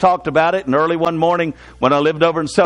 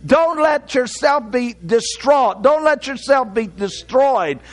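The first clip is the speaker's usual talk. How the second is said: higher-pitched, drawn-out sermon delivery; preaching; 5 semitones higher, slower than usual. Real, not acted. Preacher urging the church not to be distraught, preaching. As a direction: preach it in firm, measured phrases.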